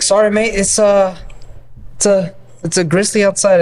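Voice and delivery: put-on light Aussie accent, playful; an easygoing Aussie accent, lightly done; Australian accent. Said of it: "why did he have that accent".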